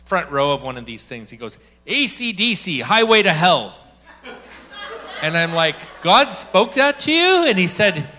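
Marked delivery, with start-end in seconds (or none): 1.9-3.8 put-on a light French Canadian accent; retelling Marcel's answer in a light French accent. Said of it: "with his French accent". 6.0-7.5 incredulous. Retold or imitated. Incredulous reply to Marcel.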